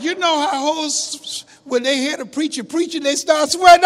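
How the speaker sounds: put-on high, loud sassy old mama; loud mother voice. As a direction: belt it out like a brash mother. Mother voiced explaining joke.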